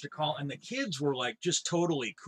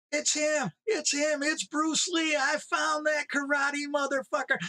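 high, thrilled shout of discovery; excited radio call; 12 semitones higher than usual. Retold or imitated. Cab driver calls in on the CB after spotting Bruce Lee, high excited shouting. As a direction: yell it like you just spotted your target.

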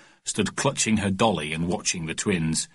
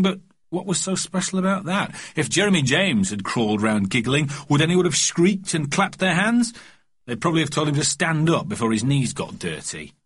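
usual speaking voice

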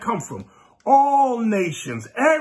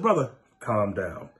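calming a brother; 11 semitones lower, 6 dB quieter than usual. Retold or imitated. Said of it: "You got an angry spirit".

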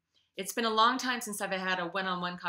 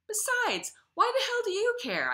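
much higher and louder, demanding; raised voice; 6 dB louder than usual. A narrator's reading. A man angrily challenging Ava, voice raised. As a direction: snap the question at her. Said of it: "I say with raised voice".

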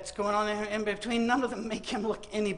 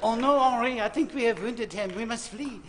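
mock French accent. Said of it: "or the French".

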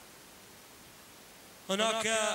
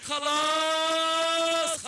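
higher, louder, stretched-out cry; long exultant shout; 8 semitones higher, 8 dB louder, much slower than usual. Real, not acted. Commentator celebrating the leader breaking away, long shout. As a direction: hold the word in one long triumphant call.

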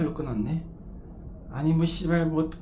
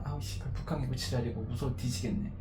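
softer, lower frightened grumble; scared; 4 semitones lower, 10 dB quieter than usual. Real, not acted. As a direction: swear under your breath, terrified. Terrified alone over sea. (겁에 질려 작게 투덜대기)

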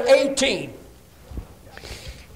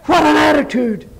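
high, raised pulpit cry; emphatic exclamation; 6 semitones higher, 4 dB louder than usual. Real, not acted. Repeated as loud exclamation.

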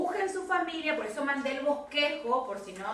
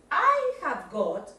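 very slow, spaced-out "I have got"; model sentence; 2 semitones higher, 4 dB louder, much slower than usual. Shown as a demonstration. Teacher shows Brian what to say, slow model delivery. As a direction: stretch each word for a learner to copy. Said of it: "y decís".

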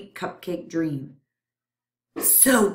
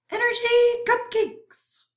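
high, strange, greedy germ voice; hungry germ voice; 4 dB louder than usual. Acted. Germ voice repeats the phrase.

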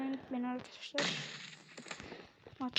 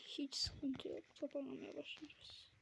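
whisper; 14 dB quieter than usual. Real, not acted. Sudden whisper aside.

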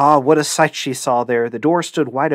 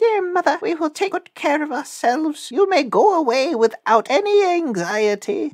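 put-on high, squeaky little-kid voices; little kids' voice; 13 semitones higher than usual. A narrator's reading. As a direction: answer as small, high-voiced kids. Kids answer their mother goat.

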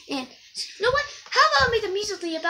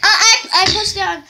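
laughing; 3 semitones higher, 10 dB louder than usual. Acted. Laughing while loud.

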